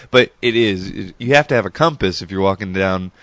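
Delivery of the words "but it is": normal talking voice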